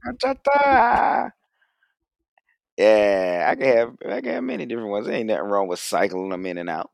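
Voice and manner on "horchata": high, drawn-out mock shout; playful calling out. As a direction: stretch the word into a silly high call. Echoing the silly high call.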